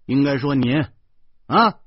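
put-on loud, agitated scolding of a child; yelling. A narrator's reading. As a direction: shout the correction, voice jumping up. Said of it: "我嚷嚷着".

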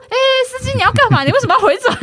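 hey driver, why are you turning back; alarmed protest. Retold or imitated.

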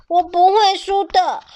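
put-on determined child. A narrator's reading.

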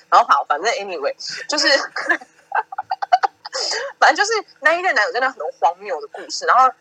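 usual speaking voice

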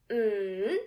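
"Mm" in a long swoosh, down then up; big third tone. Shown as a demonstration. Teacher drawing a big Nike with sound, long dipping hum. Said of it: "when the sound go up".

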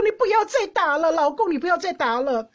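high, loud, pleading cry of a soap-opera mother; TV mom pleading. Retold or imitated. TV mother begs husband to stop beating son, pleading. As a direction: beg him to stop hitting the son, hammed-up.

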